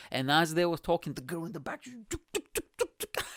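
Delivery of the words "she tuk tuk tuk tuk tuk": high, quick mock tuk-tuk of items being snatched; sneaky grabbing noises. Telling a friend about the shoplifter, high mock tuk-tuk noises. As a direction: tap out each stolen item like a cartoon thief.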